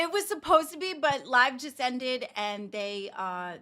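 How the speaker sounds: normal talking voice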